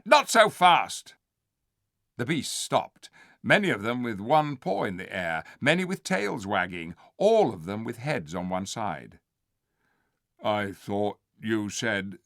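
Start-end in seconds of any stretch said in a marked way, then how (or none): 0.0-1.1 shouted. Acted. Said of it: "the ape shouted". 10.4-12.2 slow, slurring puzzled bear. Acted. The bear puzzling to the ape, slow puzzled voice. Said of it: "began the bear".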